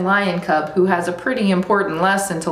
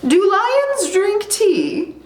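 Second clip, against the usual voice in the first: high, slow regal voice; queen; 13 semitones higher, slower than usual. Acted. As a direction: ask grandly, drawn out and high. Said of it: "The queen says".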